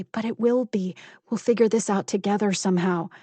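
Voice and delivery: ordinary delivery, nothing marked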